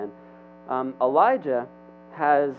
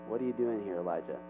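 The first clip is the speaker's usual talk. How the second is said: softer, lower, hushed reading; gentle voice; 4 semitones lower, 7 dB quieter, faster than usual. A narrator's reading. God's gentle voice to Elijah in the cave, soft and low. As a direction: let it drop down gently at the end. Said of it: "came a gentle whisper".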